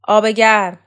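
loud, sharp call for hot water; shouted order. Acted. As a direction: bark the order at the helpers. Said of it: "شوکت فریاد زد". (فریاد دستوری بلند)

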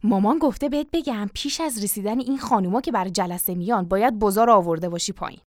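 ordinary delivery, nothing marked

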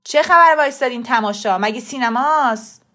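loud angry shout at onlookers; sharp, harsh. A narrator's reading. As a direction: shout to scatter a gawking crowd. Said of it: "با تندی گفت". (با تندی و صدای بلند)

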